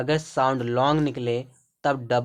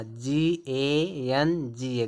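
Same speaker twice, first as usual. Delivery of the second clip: slow letter-by-letter spelling; much slower than usual. Shown as a demonstration. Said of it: "तो गंगा".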